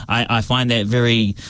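ordinary talk

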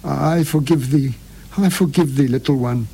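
tearful, halting forgiveness; stammering. Acted. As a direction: stammer it through tears.

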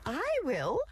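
high quacky duck voice; high eager duck quack. A narrator's reading. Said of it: "quacked the duck".

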